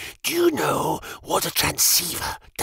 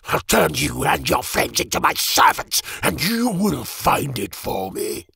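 threatening; 8 semitones higher than usual. Acted. Raging threat.

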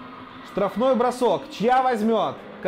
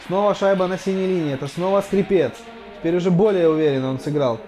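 ordinary talk

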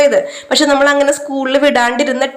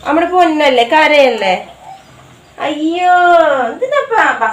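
coaxing comfort; 5 semitones higher than usual. Real, not acted. Mother coaxing her crying son before school, high pleading.

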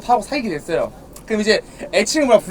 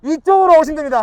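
loud, raised voice to the crowd; calling out; 6 semitones higher, 4 dB louder than usual. Real, not acted. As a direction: announce it to the whole park.

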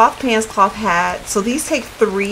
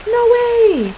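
much higher, shocked no way; disbelief; 13 semitones higher, 3 dB louder than usual. Real, not acted. Streamer shocked her fire pit vanished, high disbelief. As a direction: gasp it out in disbelief.

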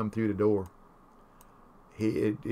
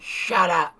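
mock shout, higher and louder; neighbor hollering; 7 semitones higher, 7 dB louder than usual. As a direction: snap it at the barking dog. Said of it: "he hollered at him and said".

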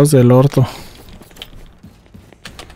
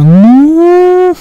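sudden high panicked shout; scream of fright; 13 semitones higher, 5 dB louder than usual. Real, not acted. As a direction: yelp in real fright. Horror game, sudden fire trap. (grito de susto)

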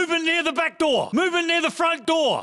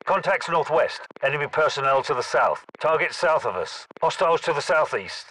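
much lower, steady military callout voice; a lower-voiced squad soldier calling targets; 12 semitones lower than usual. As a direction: report enemy positions in a low, even voice. Low-voiced soldier calling out enemies.

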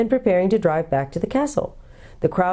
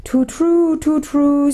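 high mournful hooting; sad owl; 10 semitones higher, 4 dB louder, much slower than usual. A narrator's reading. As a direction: hoot it sadly, like an owl. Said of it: "said the owl sadly".

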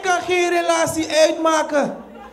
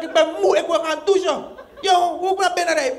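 ordinary delivery

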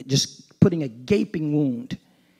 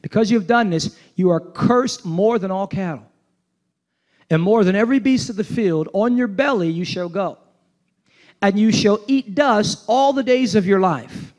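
raised, declaiming preacher voice; preaching scripture reading; 5 semitones higher than usual. Acted. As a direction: proclaim the curse to the congregation. Reads the curse aloud.

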